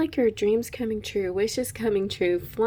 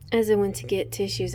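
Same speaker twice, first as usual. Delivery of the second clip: ordinary talk, nothing marked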